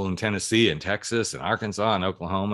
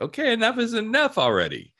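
mock exasperated, pitched way up; fed-up exclamation; 3 dB louder than usual. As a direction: throw up your hands, enough already. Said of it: "and you're like".

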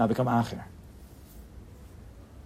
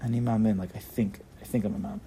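soft, low, unsure recital; hesitant half-belief, trailing down; doubtful, droopy; 4 semitones lower, 4 dB quieter than usual. Shown as a demonstration. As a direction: say it like you only think so. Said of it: "you're saying it from the place of Sichlis".

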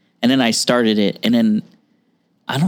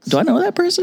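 high, puzzled double take; puzzled surprise. Retold or imitated. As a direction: ask it like you can't believe it. Retelling own puzzled reaction.

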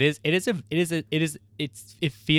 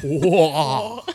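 laughing ghost whoa; 7 semitones higher, 7 dB louder than usual. Acted. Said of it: "ghost sound".

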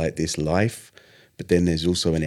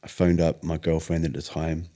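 usual speaking voice

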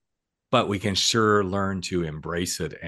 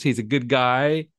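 ordinary delivery

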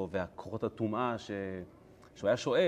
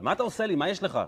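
high, raised-voice scolding; yelling; 8 semitones higher, 4 dB louder, faster than usual. Acted. Donkey yelling at Balaam before everyone, scolding yell. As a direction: scold him loudly, pitched up. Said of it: "זה שהיא צועקת עליו".

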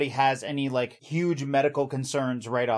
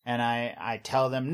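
ordinary talk, nothing marked

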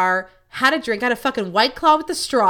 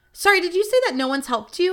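high-pitched imitation of an apologetic server; lightly acted, high apology; apologetic barista; 7 semitones higher, faster than usual. Barista checking on an ignored customer, high apologetic imitation.

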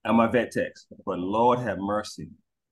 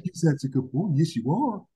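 put-on baby talk to dog; 5 semitones higher than usual. Owner baby-talks to dog.

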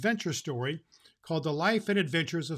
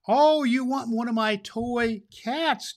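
higher, slow, delighted; tickled that kids love his toys; pleased old Claus; 8 semitones higher, 6 dB louder, slower than usual. Acted. Claus learning the children want a toy cat, delighted.

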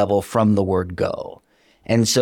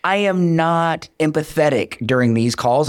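ordinary talk, nothing marked

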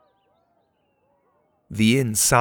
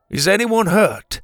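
put-on raised, concerned older man; Pa's voice; 10 semitones higher, 4 dB louder than usual. Pa asks the shoved-in kids, concerned. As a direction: check whether anyone is hurt.

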